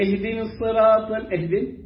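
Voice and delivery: ordinary talk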